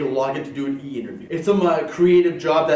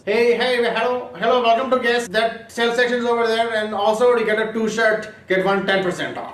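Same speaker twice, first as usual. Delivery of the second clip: put-on higher-pitched Indian accent; Indian-accented store greeter, pitched up; 5 semitones higher than usual. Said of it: "do that in an Indian accent".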